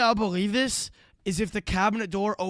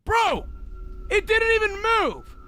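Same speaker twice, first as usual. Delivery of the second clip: excited shout; 13 semitones higher than usual. Real, not acted. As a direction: yell it in total disbelief. Reacting to a video in shock.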